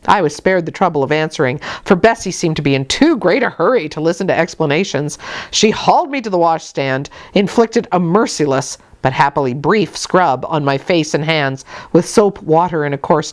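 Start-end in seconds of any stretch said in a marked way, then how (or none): none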